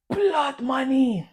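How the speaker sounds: high, long drawn-out cry of blood money; retold yell. Stranger in the club yelling again, long drawn-out cry. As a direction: let it ring out like a crazed man. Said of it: "he goes".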